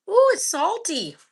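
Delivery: high, loud, slow ooh; surprised. Real, not acted. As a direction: react to the salt with surprise. Tasting something salty.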